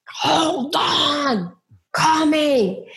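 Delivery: high call of a man from inside. Retold or imitated. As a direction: call out that you're coming.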